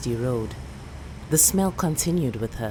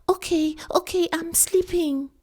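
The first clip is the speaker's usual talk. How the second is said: put-on little girl. Girl promising grandmother she is sleeping. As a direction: give in like a sleepy child.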